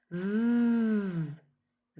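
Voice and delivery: long, vibrating "ف" as English v; drawn-out buzz on "ف", voiced like English v; buzzing V sound. Shown as a demonstration. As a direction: hold "ف" as a voiced v hum. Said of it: "الصوت اللي بيعمل vibration".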